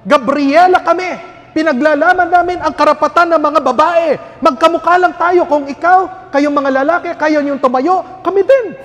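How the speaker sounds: high, loud, furious woman's rant; angry feminist woman. Retold or imitated. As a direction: yell at him as the outraged feminist. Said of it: "sinigawan ako". (galit na sigaw ng babaeng feminista)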